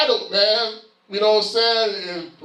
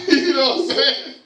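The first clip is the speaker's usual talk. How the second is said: higher-pitched, said through a laugh; 7 semitones higher than usual. Real, not acted. Speaker joking about partying at the parents' house, laughing. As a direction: laugh along with your own joke.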